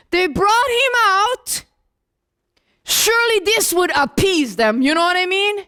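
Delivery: ordinary talk, nothing marked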